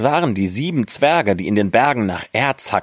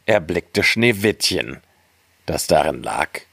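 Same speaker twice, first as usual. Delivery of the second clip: usual speaking voice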